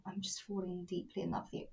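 hushed, very soft confession; mock lovestruck whisper. Pretending to confess love to partner, hushed whisper. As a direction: whisper it like a smitten lover.

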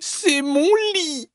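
very high, drawn-out squeal; tiny baby bear. Acted. Baby bear claiming his bed, tiny high cry. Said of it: "crie petit ours de sa toute petite voix".

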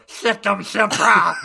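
high, loud dopey Draco. Retold or imitated. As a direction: squeak it like a silly Draco. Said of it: "our version of Draco go".

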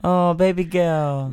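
slow, drawn-out soothing coo; tender sympathy. Real, not acted. Comforting a tearful co-host, tender and slow. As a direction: draw it out to comfort a friend.

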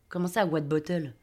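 low, soft, slow echo; baffled aside. A narrator's reading. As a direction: repeat it to yourself, puzzled. Narrator's baffled aside echoing captain.

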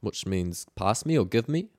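normal talking voice

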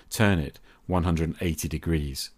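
normal talking voice

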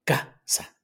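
"casa" split as CA... sa; teacher's slow demo of CA-sa; stress on CA. Shown as a demonstration. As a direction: separate the syllables of "casa", first one stressed. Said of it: "Lleva el acento en la primera A".

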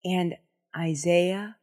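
ordinary delivery

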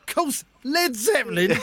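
slow, smug music-snob voice; record snob. Retold or imitated. Teasing his mate as a slow smug record collector. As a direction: lord it over him like a vinyl bore. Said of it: "'cause I'd have gone".

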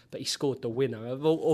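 normal talking voice

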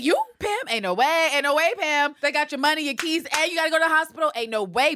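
high, loud sassy scoffing; loud, high, incredulous sass; mock disbelief. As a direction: call her bluff with loud sass. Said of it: "I said".

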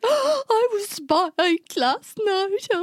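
high, slow, mock sob; lightly imitated crying; retold crying. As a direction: play the tearful confession lightly. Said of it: "I burst into tears".

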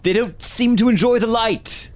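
high-pitched shout to companions; shouted warning. Acted. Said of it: "I will shout out to".

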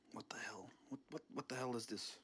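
whispered surprise. Acted. Intruder in the kitchen whispering in surprise. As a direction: hiss it softly at the fridge. Sneaking, finds fridge.

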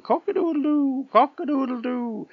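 very high, loud rooster crow; cockerel crowing. A narrator's reading. Cockerel on the roof as the robber flees, crowing high. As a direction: crow shrilly from the rooftop, twice over. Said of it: "the cockerel was crowing".

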